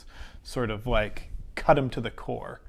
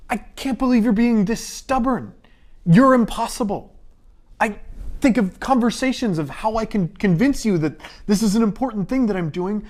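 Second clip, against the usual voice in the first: much higher and louder, frustrated pleading; exasperated outburst; 10 semitones higher, 9 dB louder than usual. Acted. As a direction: throw up your hands, exasperated. Frustrated outburst at stubborn deer.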